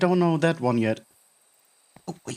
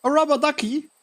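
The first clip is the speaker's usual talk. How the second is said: surprised; 11 semitones higher, 4 dB louder, faster than usual. Acted. Surprised at the catch.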